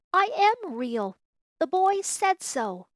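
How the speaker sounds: high, loud, drawn-out pleading; upset protest. Acted. Little rabbit cites the boy as proof, upset and protesting. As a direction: insist desperately, near to tears. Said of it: "he nearly began to cry".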